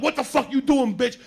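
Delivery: high, loud mock outrage; acted angry yell. Friend confronting woman with a razor, acted angry yell. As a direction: shout it as a shocked challenge.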